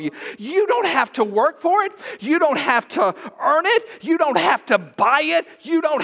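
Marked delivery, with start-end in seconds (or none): none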